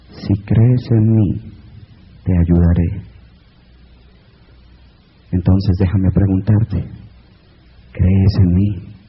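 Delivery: muy grave, lenta y más fuerte, solemne; voz de arriba. Acted. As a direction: habla como una voz divina desde lo alto. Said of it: "Y la voz dice". (la voz de arriba responde al hombre, grave y lenta)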